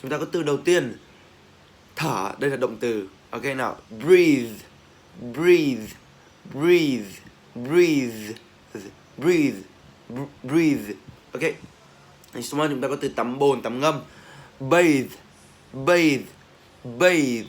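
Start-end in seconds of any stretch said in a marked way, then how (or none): 3.9-10.9 "breathe" loud, drawn out into a voiced TH; teacher's emphatic drill of "breathe"; voiced TH ending. Shown as a demonstration. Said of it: "vẫn là âm T H". 14.6-17.4 "bathe" loud, closing on a voiced TH; emphatic model of "bathe"; voiced TH ending. Shown as a demonstration. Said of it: "vẫn là âm T H".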